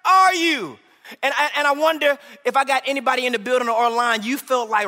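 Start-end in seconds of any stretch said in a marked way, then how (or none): 0.1-0.8 loud, high, slow anguished call; desperate cry. Retold or imitated. God's people crying out, loud and anguished. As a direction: cry out to a hidden God. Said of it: "the people were asking him".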